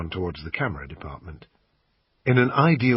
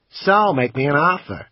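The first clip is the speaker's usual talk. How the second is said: a higher, louder American salesman pitching the camera; pawn shop salesman; 6 semitones higher, 6 dB louder than usual. Acted. Assistant pitching the camera to the customer, salesman voice. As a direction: sell it like a pushy shop assistant.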